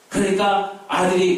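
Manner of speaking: ordinary talk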